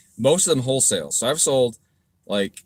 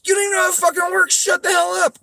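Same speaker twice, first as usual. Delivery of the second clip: much higher, louder, fast mocking yell; mock critic shouting; 6 dB louder than usual. Mocking a commenter yelling at him online. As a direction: ham up a ranting know-it-all.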